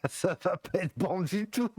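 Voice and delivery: high, giggly voice breaking into laughter; laughing. Real, not acted. Joking to listeners about eating the wrong berries, laughing. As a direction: laugh through the words, pitch climbing.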